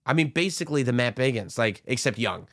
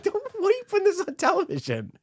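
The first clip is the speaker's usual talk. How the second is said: much higher, fast, incredulous scolding; high-pitched mock pleading; exasperated scolding; faster than usual. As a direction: squeal it at the TV like an exasperated viewer. Mocking a contestant's overshare.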